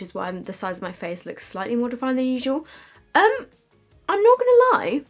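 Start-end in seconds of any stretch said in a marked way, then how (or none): 3.1-5.0 excited. Real, not acted. Nervous contour reveal.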